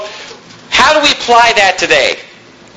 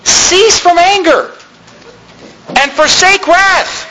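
higher and slow, each word weighed; slow emphatic scripture; 10 semitones higher, slower than usual. Acted. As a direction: lay the key verse down slowly. Reads key verse slowly.

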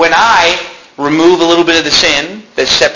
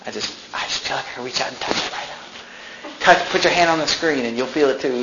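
hushed, softer swooning preacher voice dropping to a whisper; mock charismatic TV preacher; 2 semitones lower, 6 dB quieter than usual. Pastor mocking charismatic TV preachers in a hushed, swooning voice. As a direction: parody a TV faith healer leaning into the camera. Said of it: "like one of these charismatic preachers on T V".

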